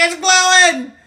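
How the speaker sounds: high, loud astonished cry; amazed outburst. Acted. Unicorn seeing the horn glow, amazed high yell. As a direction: call it out in wonder.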